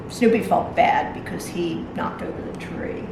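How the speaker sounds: ordinary delivery, nothing marked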